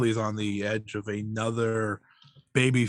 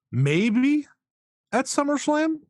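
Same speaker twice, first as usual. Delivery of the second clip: hopeful maybe; 4 dB louder than usual. Real, not acted. Hopeful guess then doubts.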